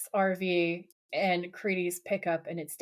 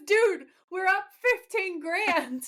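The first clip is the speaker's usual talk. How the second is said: high, drawn-out gleeful exclamation; mock-thrilled, slow high-pitched delivery; imitated excitement; 9 semitones higher, slower than usual. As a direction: stretch it out high, like a thrilled buddy bragging. Acting Dean thrilled by the winnings.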